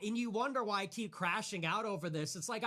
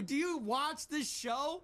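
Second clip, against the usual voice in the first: ordinary talk, nothing marked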